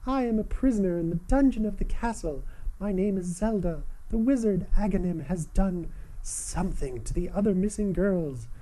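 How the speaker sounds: high princess voice reading a plea; Princess Zelda voice. Acted. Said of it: "my Princess Zelda voice".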